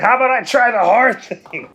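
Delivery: high, loud exasperated plea. Real, not acted. Gamer pleading with the game to try the hard level.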